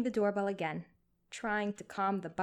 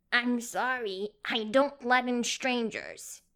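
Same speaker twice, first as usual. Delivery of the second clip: put-on higher, louder, slow old man's voice; craggy cranky old man; 4 semitones higher, 5 dB louder, slower than usual. A narrator's reading. Elderly man refusing the nurse through the door, cranky. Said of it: "a craggy, cranky man's voice".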